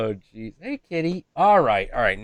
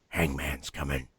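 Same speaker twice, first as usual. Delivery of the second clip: creepy raven voice; 10 semitones lower, 9 dB quieter than usual. Retold or imitated. Imitating Raymond the raven's creepy phrase, low and eerie. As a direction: make it sound like a bird that could haunt you. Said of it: "he says one phrase over and over".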